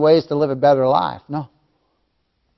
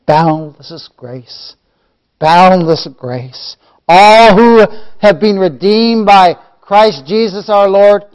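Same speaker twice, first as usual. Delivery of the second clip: loud, swelling proclamation; full-force preaching; 4 semitones higher, 11 dB louder, much slower than usual. Real, not acted. Preacher proclaiming boundless grace to the redeemed, full-force preaching. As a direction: stretch the opening words, then build higher and louder.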